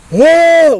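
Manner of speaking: reenacting a loud breakdown wail; imitated wail. Hammed-up imitation of a loud wail.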